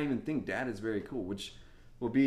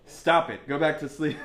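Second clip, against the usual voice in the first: louder mock scold; 4 semitones higher, 8 dB louder than usual. Tired dad at 5am.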